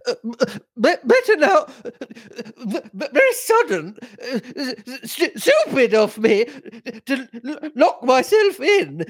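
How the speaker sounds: high, broken, stammering gasps; gasping in pain. Acted. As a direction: force each word out between gasps. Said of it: "She was able to speak in short gasps".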